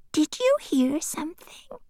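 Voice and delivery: hushed whisper. Acted. Tiffany whispers to Annabel about a noise. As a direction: whisper so nothing else hears. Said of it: "she asked".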